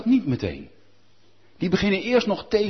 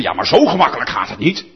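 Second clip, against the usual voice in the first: protesting preachers; 4 semitones lower, 7 dB louder than usual. Retold or imitated. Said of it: "alle dominees natuurlijk roepen".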